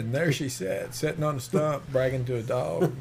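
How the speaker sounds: lightly put-on Arkansas accent; folksy mock-Arkansas drawl; slow Southern drawl. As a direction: tell it like a Southern good old boy, drawling. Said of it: "that slow drawl".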